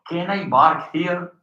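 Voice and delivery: park said as bark, drawn out slowly; slow question with park turned into bark. Retold or imitated. Retelling the professor's slip to the class, park said as bark. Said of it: "Instead of park".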